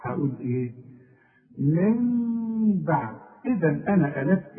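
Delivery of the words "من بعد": من بعد hummed as a meem, slow; iqlab ghunna. Shown as a demonstration. Teacher shows connected recitation, slow nasal ghunna. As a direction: hold the nasal hum two beats. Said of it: "لكن إذا وصلت".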